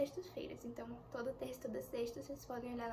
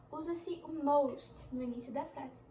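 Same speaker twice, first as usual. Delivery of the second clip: normal talking voice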